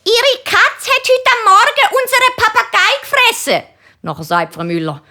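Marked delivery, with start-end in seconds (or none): 0.0-3.6 high, excited gossip voice; breathless neighbour breaking big news, high and excited. Retold or imitated. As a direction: blurt it out, worked up and much higher. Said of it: "sagt ganz aufgeregt".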